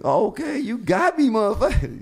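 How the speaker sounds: high, loud grudging concession; half-laughing, admit you got played; hammed-up high and loud, tipping the hat to the hustler; rueful mock outrage. Conceding he was ripped off.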